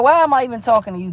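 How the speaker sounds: ordinary delivery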